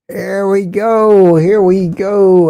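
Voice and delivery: slow, suspenseful game-show reveal; winner announcement. Pretend. Host reveals the day's winner to chat, drawn-out announcer style. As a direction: stretch the words out to build suspense.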